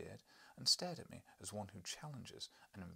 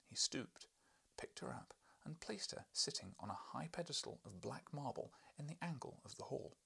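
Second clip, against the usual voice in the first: ordinary delivery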